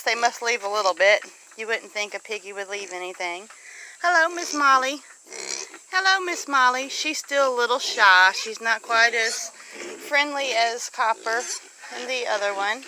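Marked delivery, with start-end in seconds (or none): none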